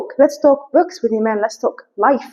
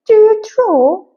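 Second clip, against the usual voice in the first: very high, slow put-on accent; Lady Catherine; 10 semitones higher, 5 dB louder, slower than usual. Lady Catherine quizzes Elizabeth, high affected accent. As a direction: draw it out, high and louder, in an affected voice. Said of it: "I don't know where I took this accent to".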